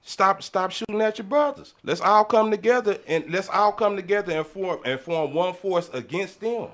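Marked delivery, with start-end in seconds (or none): none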